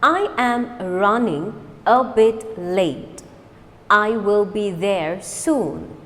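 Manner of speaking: slow, deliberate model sentence. Shown as a demonstration. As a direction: lay each word out slowly. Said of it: "Now fast".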